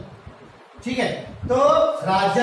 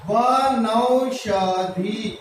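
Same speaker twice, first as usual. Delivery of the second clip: slow drawn-out demo; 2 semitones lower, much slower than usual. Shown as a demonstration. Teacher sounding out वनौषधि slowly for the students. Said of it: "ये लगाए औ की मात्रा".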